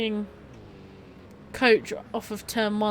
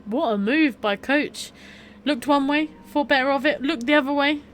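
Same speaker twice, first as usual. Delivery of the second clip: excited; 5 semitones higher, 5 dB louder than usual. Real, not acted. Praising overtake just seen.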